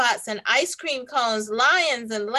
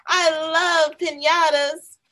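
high, loud and slow delight; excited; 7 semitones higher, 5 dB louder, slower than usual. Acted. Samson telling Clara he loves piñatas, excited. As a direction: gush it with excitement.

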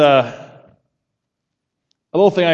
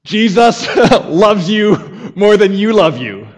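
laughing; 6 semitones higher, 6 dB louder, slower than usual. Real, not acted. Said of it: "laughing repeat of phrase".

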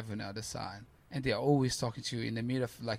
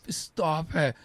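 louder, higher disbelieving cry; mock scream; 5 semitones higher, 6 dB louder than usual. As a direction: shout it like you can't believe the news. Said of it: "He's screaming".

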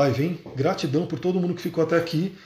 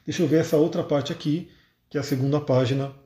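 ordinary talk

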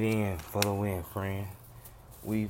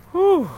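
loud whew; 10 dB louder than usual. Real, not acted. Exhale exclamation.

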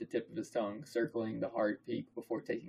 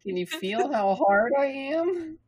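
high, loud, slow silly voice; absurd character voice; 9 dB louder, slower than usual. Pretend. Reader clowning for co-host, high drawn-out voice. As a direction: stretch it out, pitched way up.

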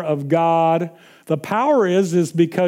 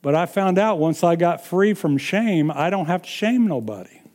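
ordinary talk, nothing marked

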